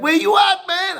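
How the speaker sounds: high, loud buddy on the phone; friend calling out. Retold or imitated. Friend on the phone asking where he is, high and loud. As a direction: call out like a puzzled friend. Friends phoning hours later.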